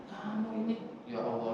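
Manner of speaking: put-on under-the-breath exasperated scold at the child; muttered scolding. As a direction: mutter it, barely held back. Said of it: "Kita pengennya sih". (omelan pelan yang ditahan)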